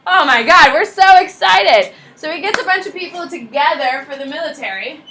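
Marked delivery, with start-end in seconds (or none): 0.1-1.9 loud, gushing relief with a slowed ending; hammed-up crowd cheering their savior; relieved excitement. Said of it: "And people are like".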